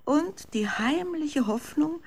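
ordinary talk, nothing marked

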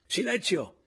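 high, loud call for silence; commanding order. A narrator's reading. Signor Anselmo orders silence at the séance, commanding. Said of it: "intimò a questo punto il signor Anselmo".

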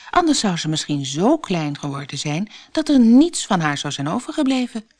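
ordinary delivery

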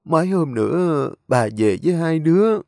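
put-on an old lady calmly settling her affairs before death; frail grandmother. Grandmother comforts the children.

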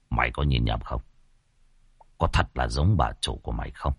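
put-on an old master asking anxiously under his breath; old master's hurried question. Old master questioning the servant, low and hushed. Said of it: "lão vội nói".

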